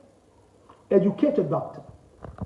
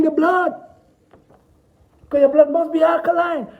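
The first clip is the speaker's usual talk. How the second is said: raised, higher, louder insistence building to a shout; forceful preaching; 8 semitones higher, 5 dB louder than usual. Real, not acted. Urging cancer patients to alkaline their blood, rising shout. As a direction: hammer every must home. Urgent lecture on cancer nutrition.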